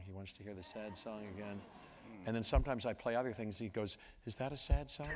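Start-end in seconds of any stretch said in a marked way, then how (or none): 4.2-5.1 lightly voiced little boy, pitched up; hint of a curious small child; three-year-old son. Toddler son asking dad, lightly imitated child voice. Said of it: "he's three, a little over three".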